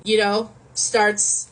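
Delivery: usual speaking voice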